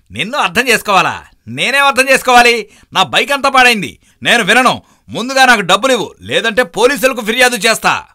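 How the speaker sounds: high, loud, indignant shouting; angry demand. Acted. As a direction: refuse to listen and demand payment. Biker demands money, threatens police.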